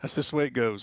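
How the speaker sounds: normal talking voice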